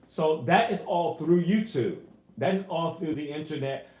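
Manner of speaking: ordinary talk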